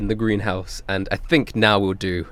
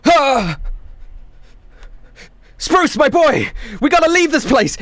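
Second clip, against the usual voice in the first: high, urgent exclamation; dad waking in a panic; alarmed father; 3 dB louder than usual. Acted. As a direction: gasp awake and call out urgently. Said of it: "comes to and he goes".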